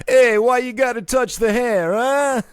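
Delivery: loud, high-pitched, hammed-up Jersey accent; caricature New York-Jersey accent. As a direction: do a terrible Travolta-style New York accent. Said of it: "New Jersey, New York accent".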